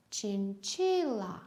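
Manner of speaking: slow chinchilla with CHI held long; stress on CHI. Shown as a demonstration. Teacher answering where is the stress, stress on CHI. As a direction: stretch the second syllable CHI in chinchilla. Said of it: "it's on the second".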